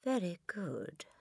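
soft, slow, reluctant mumble; mumbling. A narrator's reading. Boy admitting he liked the cake, mumbling. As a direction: mumble it slowly under your breath. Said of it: "the boy mumbled".

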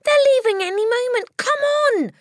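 high, loud urgent shout. A narrator's reading. Matilda shouts to Miss Honey to hurry. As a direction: yell to hurry her along. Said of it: "she shouted".

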